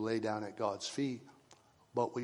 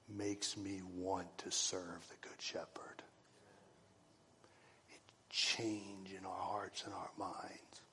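slow, much softer whisper; 3 semitones lower, 10 dB quieter, slower than usual. Real, not acted. Preacher whispering his heart about serving the Shepherd. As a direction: let it fall to an intimate whisper.

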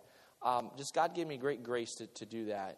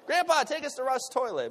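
put-on child's plea; 9 semitones higher, 5 dB louder than usual. Child asking grandfather.

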